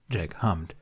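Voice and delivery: ordinary delivery, nothing marked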